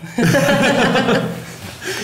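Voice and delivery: real high-pitched laughing fit; laughing hard and high at the teasing. Real, not acted. Host cracks up after being teased about his humming. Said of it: "You sound like Danielle".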